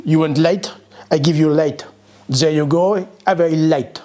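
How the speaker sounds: hammed-up French accent; mock French accent, short offhand phrases; bad French accent. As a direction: put on a comically poor French accent. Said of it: "Sorry about my French".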